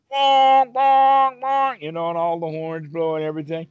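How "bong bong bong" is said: high, loud imitated bell chimes; a teacher imitating New Year bells, high and loud; bell bongs. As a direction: ring out like the midnight bell. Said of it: "making a lot of noise".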